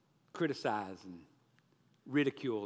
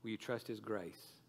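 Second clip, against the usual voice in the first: low, soft, earnest pleading; gentle gospel appeal; 3 semitones lower, 5 dB quieter than usual. Real, not acted. Preacher calling listeners to believe, hushed earnest appeal. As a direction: invite them quietly, with long pauses.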